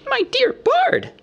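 very high, drawn-out hobbit squeak. A narrator's reading. Bilbo protests Bard's accusation, squeaking. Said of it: "squeaked Bilbo".

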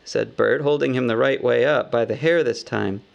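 plain reading voice

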